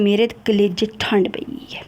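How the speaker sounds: whispered bitter aside; hushed, sarcastic aside. Real, not acted. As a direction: drop to a whisper, half to yourself. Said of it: "whispered aside".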